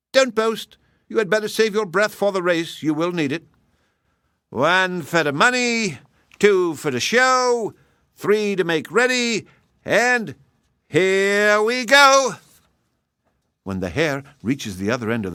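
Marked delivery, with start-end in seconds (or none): 0.1-3.5 higher, teasing wife voice; hedgehog's wife taunting the boastful hare. Acted. 4.5-12.4 high, slower count ending in a long loud go; slow race count-off. Acted. Hare counts final race.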